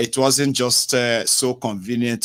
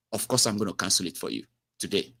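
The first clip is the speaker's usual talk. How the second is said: soft reassuring agent; 3 semitones lower, 8 dB quieter, faster than usual. Retold or imitated. Agent soothing reply.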